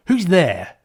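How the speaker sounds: loud, high challenge; yell. Acted. Tails yelling at a shadowy figure in the trees. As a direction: shout up at the stranger. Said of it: "Tails yells at the shadowy figure".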